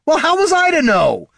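very high, loud defensive protest; indignant protest. A narrator's reading. Bard defending himself to the dwarf, indignant. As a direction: protest in a squabble.

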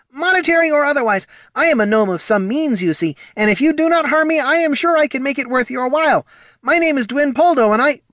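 high, loud, rushed hysterical stream; hysterical babble. Acted. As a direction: pour out words in panic. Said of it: "nearly hysterical stream".

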